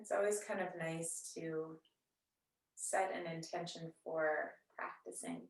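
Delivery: soft, unhurried yoga-teacher voice with long pauses; guided meditation. Real, not acted. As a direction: gently guide them into settling and noticing the breath. Leads seated breathing meditation.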